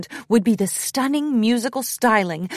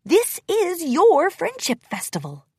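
higher-pitched pep talk; self-encouragement in a higher character voice; talking to herself; 8 semitones higher than usual. A narrator's reading. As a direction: urge yourself on. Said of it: "she told herself".

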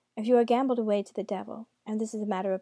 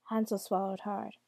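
plain reading voice

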